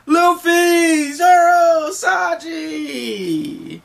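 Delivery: very high, loud, drawn-out cries; excited name-calling. Acted. Crew greets three returning friends, excited shouting. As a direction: shriek each friend's name in welcome.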